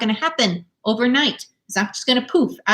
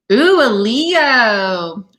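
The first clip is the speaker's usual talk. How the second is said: drawn-out delight; 2 semitones higher, 5 dB louder, much slower than usual. Real, not acted. Delighted at a viewer's sign.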